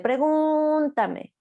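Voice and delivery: very slow and high, stretched syllables; high, slow echolalic repetition; drawn-out echo. Acted. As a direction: drag it out like a child echoing. Child's echolalia acted out.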